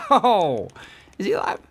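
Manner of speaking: ordinary talk, nothing marked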